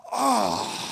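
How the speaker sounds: high put-upon "Oh"; dramatic Broadway choreographer. Retold or imitated. Dad reacting to son's spill, theatrical diva gasp. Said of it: "And I'll just be like".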